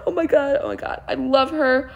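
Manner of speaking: ordinary delivery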